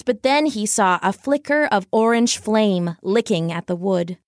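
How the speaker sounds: ordinary delivery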